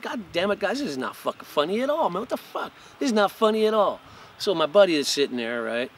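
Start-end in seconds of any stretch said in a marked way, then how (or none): 0.0-4.0 higher-pitched upset scolding; retold angry outburst. Scolding his laughing friends as Jack nearly died, angry. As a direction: tell the laughing buddies off. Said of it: "and I'm like".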